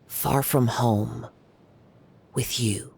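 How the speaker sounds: slow, tentative final verse line; reciting a haiku. Acted. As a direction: offer the last line slowly, a little unsure. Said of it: "the final line is coming to me".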